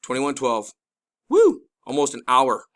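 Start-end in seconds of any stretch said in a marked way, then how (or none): 1.3-1.6 high, loud, sudden woo; excited whoop. Real, not acted. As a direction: whoop out in amazement. Exclaims at what the video shows.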